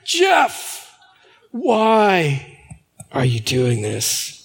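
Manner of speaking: booming call from above, then slow and low; God calling Saul down from heaven. Retold or imitated. A pastor voicing Jesus calling him by name. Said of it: "Voice from heaven".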